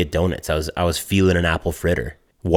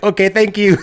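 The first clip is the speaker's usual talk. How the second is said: mock cashier; 14 semitones higher, 4 dB louder than usual. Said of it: "And she's like".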